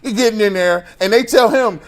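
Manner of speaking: ordinary delivery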